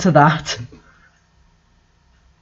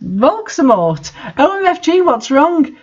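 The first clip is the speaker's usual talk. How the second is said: high, shocked squeal; alarmed; 9 semitones higher than usual. A narrator's reading. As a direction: gasp it out in mock alarm. Alarmed cry at crying Voldemort.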